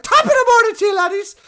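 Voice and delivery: screamed, high-pitched mock Irish greeting; Jacksepticeye screaming. Podcaster imitating a YouTuber's intro, screaming in an Irish accent. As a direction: imitate an over-the-top Irish YouTuber yelling. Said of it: "he starts screaming at the fucking camera".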